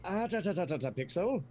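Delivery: put-on high, loud tutting at a kid; tsk-tsk him like a naughty child; scolding tut-tut. Scolding the squirming goblin kid.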